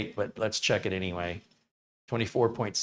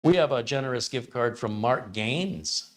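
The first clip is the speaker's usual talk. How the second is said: usual speaking voice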